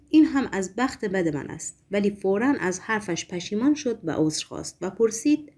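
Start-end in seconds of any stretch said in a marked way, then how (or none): none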